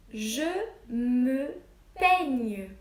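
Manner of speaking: je me peigne said loud and slow with a falling peigne; dictation phrase. Shown as a demonstration. Teacher dictating the phrase to her pupils.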